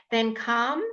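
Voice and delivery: calm as kahm, no l; silent l calm. Shown as a demonstration. Teacher repeating calm for the quiz. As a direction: say calm without the l. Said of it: "Which letter is silent?".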